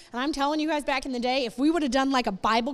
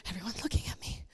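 whispered, paranoid aside; mock fearful whisper; anxious whisper; 6 semitones lower, 11 dB quieter, faster than usual. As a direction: hush it like you're being watched. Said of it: "I used to be like".

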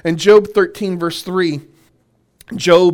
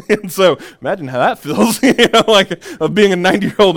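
laughing; 4 semitones higher, 6 dB louder than usual. Real, not acted. Laughing at Sarah story.

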